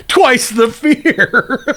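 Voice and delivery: drawn-out giggly announcer parody; laughing mock tagline. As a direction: crack up mid-tagline. Mock trailer tagline while laughing.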